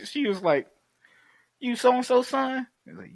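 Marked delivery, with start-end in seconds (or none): 1.6-2.7 higher, slow questioning woman's voice; slowly ask, voiced up as her; imitating a curious woman. Woman asks whose son he is, curious. Retold woman recognises the young man.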